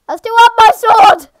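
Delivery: high, loud exclamation; excited shout. Real, not acted. Player finding they are still armed, high excited shout. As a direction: shout it out mid-fight. Mid-fight realisation.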